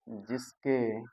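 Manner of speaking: word by word, long pauses between each; slow dictation. Shown as a demonstration. Teacher dictating the definition to the class, slow word by word. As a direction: dictate it slowly so students can write. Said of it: "dictation pauses".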